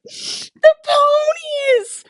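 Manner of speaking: drawn-out, very high squeal of joy; excited squeal. Retold or imitated. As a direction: squeal the word out slowly in delight. Excited announcement to husband.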